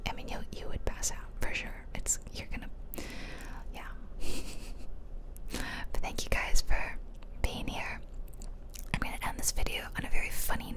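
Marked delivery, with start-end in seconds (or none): 3.9-5.9 hushed, breath-only giggle; whispered laugh. Real, not acted. Gamer joking that viewers would pass out, whispered giggle.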